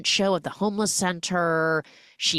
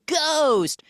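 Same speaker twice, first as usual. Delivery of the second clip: gleeful refrain; 10 semitones higher, 4 dB louder than usual. Pretend. Punchline refrain over montage.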